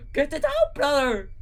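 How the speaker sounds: very high loud shriek. Acted. Gru shrieking at Drew to get it out. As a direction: shriek in panic. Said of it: "Gru shrieked".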